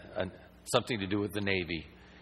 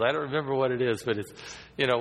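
ordinary talk, nothing marked

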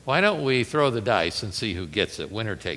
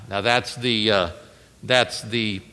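ordinary delivery

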